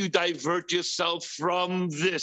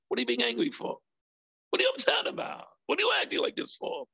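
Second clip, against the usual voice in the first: mock questioning; 7 semitones higher, much faster than usual. Mock questioning an angry person.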